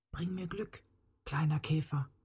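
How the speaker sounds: very soft, slightly high voice; hushed wish. A narrator's reading. Girl making a wish to the ladybird, hushed. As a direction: whisper a small plea.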